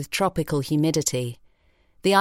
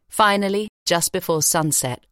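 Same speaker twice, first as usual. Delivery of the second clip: ordinary delivery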